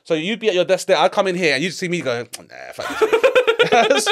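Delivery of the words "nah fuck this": laughing walkout. Retold or imitated. Walking out, slow, high, laughing.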